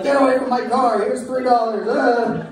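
high, flustered shooing; hammed-up panicked driver; mock panic. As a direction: shoo him off in a fluster. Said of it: "We're like".

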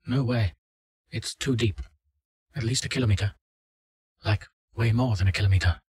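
urgent, uneasy report from the dark; frightened woman. Acted. Subject answers in awe.

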